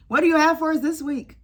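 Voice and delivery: loud mock plea; calling out. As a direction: call out to the showrunner. Calling out to Tyler Perry.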